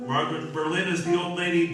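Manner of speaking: usual speaking voice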